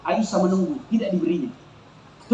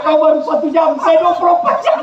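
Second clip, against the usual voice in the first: loud retort; 6 semitones higher, 5 dB louder than usual. Retold or imitated. Husband's punchline retort to jinn.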